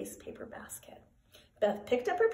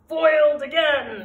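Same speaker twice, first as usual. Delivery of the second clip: loud, higher-pitched villain's shout; hammed-up shout of defeat; 5 semitones higher, 8 dB louder than usual. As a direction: shout it like a thwarted little villain. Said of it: "shouted the germ".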